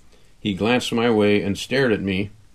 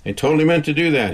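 embarrassed quick cover; 7 semitones higher, 4 dB louder, faster than usual. A narrator's reading. Caterer covers embarrassing fall.

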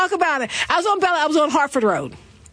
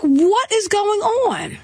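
slow, higher and incredulous; shocked disbelief; 5 semitones higher, much slower than usual. Retold or imitated. At the gas pump, slow shocked disbelief. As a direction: stretch out the disbelief. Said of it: "I was like".

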